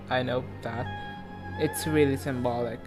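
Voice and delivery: ordinary talk, nothing marked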